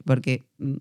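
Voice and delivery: normal talking voice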